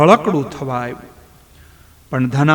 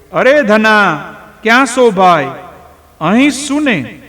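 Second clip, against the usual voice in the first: louder, much higher shout across the field; loud call; 7 semitones higher, 6 dB louder than usual. Acted. Rambhai shouts louder for his missing son across the field. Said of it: "વળી મોટેથી પડકારો કર્યો".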